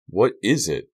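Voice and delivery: impatient. Acted. Carrie pressing nervous Mandy to get to the point, impatient. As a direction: hurry her along, out of patience. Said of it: "Carrie asked impatiently".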